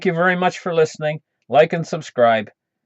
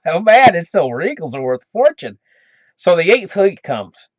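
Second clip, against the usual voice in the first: ordinary delivery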